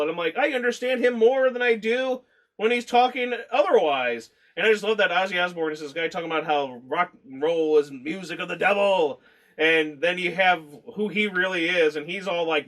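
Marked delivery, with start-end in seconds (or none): none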